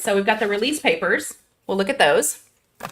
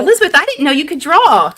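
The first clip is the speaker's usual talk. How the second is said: high, loud burst of amazement; amazed surprise; 6 semitones higher, 5 dB louder than usual. Real, not acted. Host learning her friend can draw, amazed. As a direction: exclaim in delighted surprise at a friend's talent.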